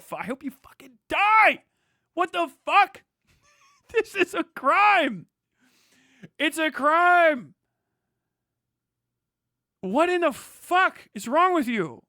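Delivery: high, loud, slow enraged yelling; furious shout. Real, not acted. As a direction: scream it at the donors.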